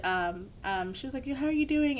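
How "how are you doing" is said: mock friendly doctor. Voicing doctor's greeting.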